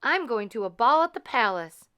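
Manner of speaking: thrilled announcement; excited. A narrator's reading. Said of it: "she cried".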